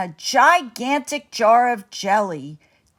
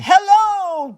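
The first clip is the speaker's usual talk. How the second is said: high, loud call; 10 semitones higher, 6 dB louder than usual. Acted. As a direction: call up to the castle. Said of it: "no, let me do that voice".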